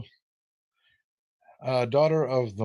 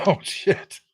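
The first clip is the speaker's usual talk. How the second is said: whispered curse; 5 semitones higher than usual. Real, not acted. Sudden slip while hosting trivia.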